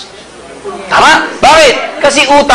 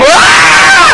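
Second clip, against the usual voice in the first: mock yelp. Commuter pushed into the MRT, high mock yelp. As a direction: yelp as the crowd shoves you in. Crushed entering MRT doors.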